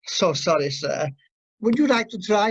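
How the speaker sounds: ordinary delivery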